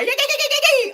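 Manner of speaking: high-pitched mocking "yee" whoops; shrill-pitched party mimicry; mock revelry. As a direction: squeal a string of yees, making fun of revellers. Mimics the group living it up.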